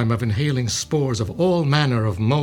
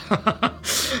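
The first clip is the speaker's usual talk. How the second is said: breathy rising villain chuckle; a gleeful giggle at the world's doom; evil laugh; 7 semitones higher than usual. Acted. Villain gloats over world plan.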